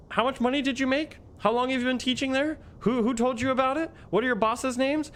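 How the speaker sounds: very high-pitched imitated questioning; mock interrogation. Officer typing as he questions the teacher, mock interrogation.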